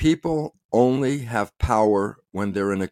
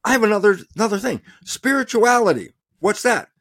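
higher, excited mock realisation, as if just catching on; playful pretend discovery; mock surprise; 9 semitones higher than usual. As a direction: act out a sudden eureka, pitched up. Acts out a sudden realisation.

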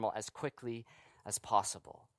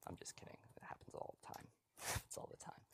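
joking whisper, then a laugh; whispered aside; 23 dB quieter, faster than usual. Real, not acted. To the congregation, joking about his wife Sandra in a whisper. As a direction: lean in and whisper the joke.

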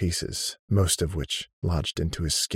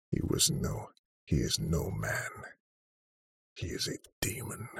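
whispering; 2 semitones lower, 8 dB quieter than usual. Acted. Odon whispers in dread.

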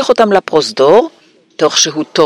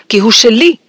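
high indignant claim; furious; 8 semitones higher, 4 dB louder than usual. A narrator's reading. Boy claiming his seized letter, high angry voice. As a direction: insist angrily on ownership. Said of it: "אמר הארי בזעם".

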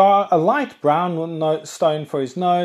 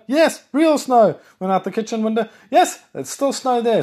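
high, delighted exclamations; gleeful at real snow; excited; 8 semitones higher than usual. Retold or imitated. Retelling looking out at snow.

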